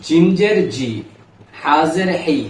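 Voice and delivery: "জিম জের জি", "হা জের হি", spelled out with clear syllables; teacher models the kasra sound on each letter; reciting letter drills. Shown as a demonstration. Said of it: "খেয়াল করি".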